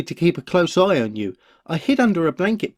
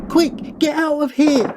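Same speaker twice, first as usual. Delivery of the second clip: panicked urge; 9 semitones higher than usual. Acted. Panicked retreat from the gang.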